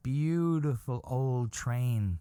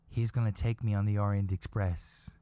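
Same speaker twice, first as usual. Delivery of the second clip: ordinary talk, nothing marked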